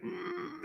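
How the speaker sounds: high, quiet strained hum; small squeezed grunt, imitating a tensed-up child; imitated tense grunt. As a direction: hold the breath in a mock-mad "mm". Son's mad face, breath held.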